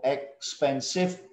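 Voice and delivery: "Expensive" in three separate beats, ex-pen-sive; syllable by syllable. Shown as a demonstration. Teacher repeating for students, "Expensive" broken into three syllables. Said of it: "that's three syllable".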